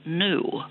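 "new" said as noo; yod-dropped new. Retold or imitated. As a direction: drop the y-glide from "new". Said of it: "English people ... will say".

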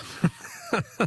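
high squeaky laugh. Real, not acted. Caller's wrong answer amuses host.

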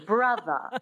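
BROTHER ending in a schwa, no R; dropped R. Shown as a demonstration. Coach modelling transatlantic, brother without R. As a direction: drop the R in BROTHER. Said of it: "you drop the R and you make it a schwa".

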